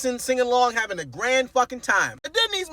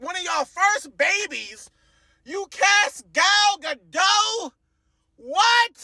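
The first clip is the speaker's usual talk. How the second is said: disbelieving outburst; 7 semitones higher, much slower than usual. Real, not acted. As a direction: drag out the question, then blurt the "what". Ranting about miscasting Gal Gadot.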